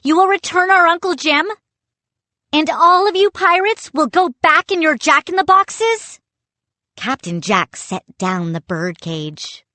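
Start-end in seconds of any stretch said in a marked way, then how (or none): 0.0-6.2 high, loud, fast demand; insistent demand. A narrator's reading. Boy insists the captain keep his bargain, loud and fast. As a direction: rattle off the conditions urgently.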